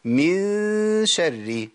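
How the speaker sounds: من شر with the nun hidden, nasal held; nasal concealment. Shown as a demonstration. Teacher demonstrating ikhfa on من شر, slow nasal hold. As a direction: stretch the nun in من شر into a long nasal glide. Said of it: "أقولها إيه؟".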